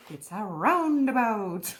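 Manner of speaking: slow and amused, still half-laughing; drawn out, laughing at the obvious word; amused realization. Real, not acted.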